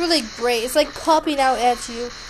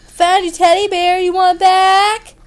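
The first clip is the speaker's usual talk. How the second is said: loud, higher-pitched holler; playful raised-voice call; 5 semitones higher, 9 dB louder than usual. Pretend. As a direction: shout the offer to someone out of sight. Calling out to a game character.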